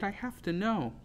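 high-pitched plea; pleading. A narrator's reading. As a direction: plead to be told. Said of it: "I pleaded".

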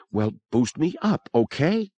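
a touch of strain in the voice; controlled fear. A narrator's reading. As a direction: keep pain and fear under control. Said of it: "controlled, but tight with pain or fear".

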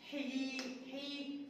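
حي drawn out slowly and softly; slow soft phonics demo. Shown as a demonstration. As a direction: sound out حي very slowly for the children. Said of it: "teacher sounds out letter sound".

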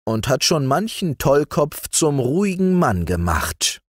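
a hint of a courtly sorcerer making his case; lightly voiced persuasive sorcerer. Zacher persuades the widow.

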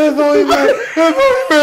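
high, loud mock holler; acted calling out. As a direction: shout up as if lost in a crowd. Acts friend calling up from crowd.

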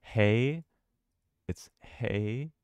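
drawn-out hey with the vowel of day, rising; sounding out. Shown as a demonstration. Gamer working out the first syllable from a key, sounding out hey. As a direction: sound out hey slowly, lifting at the end. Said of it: "So it's A, so it's hey".

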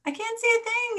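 very high, loud squealing yell; high-pitched alarmed shout; panicked yell. A narrator's reading. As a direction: yell it out in a little bird's shrieky panic. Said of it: "yelled Pip".

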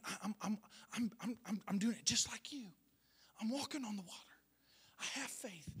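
stammering hushed whisper; whispered prayer. Retold or imitated. Believer telling God he has faith, whispered.

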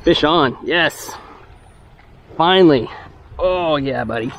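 thrilled angler calling out a catch; excited. Real, not acted. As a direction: burst out as the fish finally bites. Hooks a fish at last.

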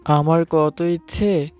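ordinary delivery

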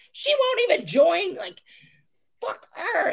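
high-pitched sulky protest; whiny petulant protest. Retold or imitated. Recruiter sulking to her senior, high and petulant. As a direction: protest like a stubborn child. Refusing senior's order, whining.

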